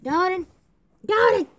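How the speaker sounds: loud high call across the house. Pretend. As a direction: call the child from far away. Mother calling out to child.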